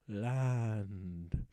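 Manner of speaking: low mock echo; deep dramatic echo. Shown as a demonstration. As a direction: echo "Land." back in a low, distant voice. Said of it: "in the land".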